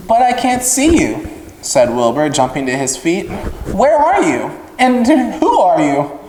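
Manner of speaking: jumping up in surprise; startled piglet. Acted. Wilbur calling into the dark, high and startled.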